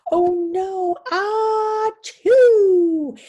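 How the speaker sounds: loud building ah-ah-choo; loud hammed-up sneeze; big sneeze. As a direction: wind up and blast the sneeze. Acting the bear's sneeze.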